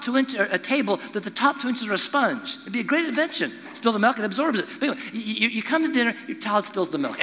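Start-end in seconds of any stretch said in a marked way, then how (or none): none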